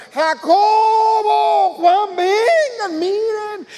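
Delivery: long, high, loud holler across water; calling out. Acted. Peter calling his partners to the sinking boat, hollered shout. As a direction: shout to far-off friends, stretching the vowels. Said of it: "Pedro le chifla a Jacobo y a Juan".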